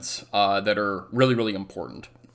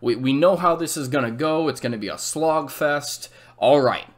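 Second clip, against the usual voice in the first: higher, mock-confident planner voice; smug French generals; 6 semitones higher than usual. A history teacher voicing the French generals, lightly mocking and sure of themselves. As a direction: lightly play a cocky general who is sure of the old plan. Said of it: "And their thinking is, is like".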